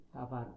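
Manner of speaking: 다바르의 '바'에 힘을 실어 길게; '다바르'에서 마지막 음절 '바르'를 강조; 끝음절 강세. Shown as a demonstration. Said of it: "강세가 마지막 음절인". ('다바르'의 끝음절에 강세)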